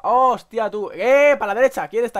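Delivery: sudden loud alarm; excited shout. Real, not acted.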